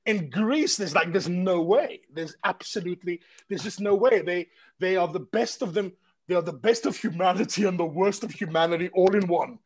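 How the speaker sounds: ordinary talk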